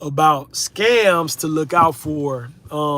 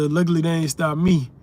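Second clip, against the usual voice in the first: ordinary talk, nothing marked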